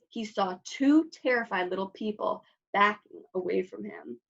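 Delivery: plain reading voice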